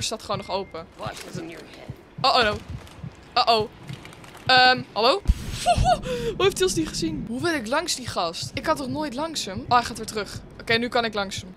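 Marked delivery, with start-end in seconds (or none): 5.3-6.3 nervous laugh. Real, not acted. Nervous reaction to the enemy.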